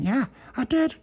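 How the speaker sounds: very high little voice; high-pitched awed reply; amazed child. Acted. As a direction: answer high, full of wonder. Dolores answers, amazed.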